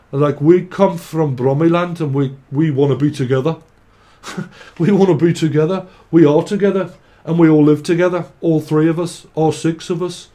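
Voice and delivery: loud, hammed-up Birmingham accent; Brummie accent. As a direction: play a cheery Brummie couple, half-joking. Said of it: "Someone's a Brummie".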